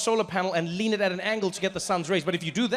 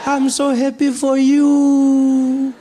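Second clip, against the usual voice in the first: put-on high, slow, delighted old granny; high slow loud granny gushing; grandmother voice; 8 semitones higher, 5 dB louder, much slower than usual. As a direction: stretch it out like a doting gogo. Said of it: "And she's like".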